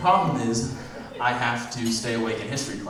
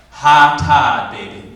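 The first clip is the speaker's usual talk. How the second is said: sassy clerk; 3 semitones higher, 12 dB louder, much slower than usual. Acted. Clerk's absurd excuse.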